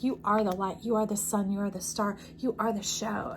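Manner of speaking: ordinary delivery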